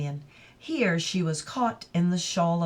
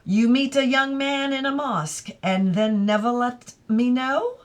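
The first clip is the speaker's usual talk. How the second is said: higher, bright teasing voice; teasing young woman; 5 semitones higher, 3 dB louder than usual. Acted. Adela to Mrs Moore, higher playful teasing. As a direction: playfully scold the older woman.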